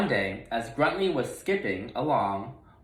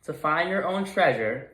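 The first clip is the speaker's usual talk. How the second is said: usual speaking voice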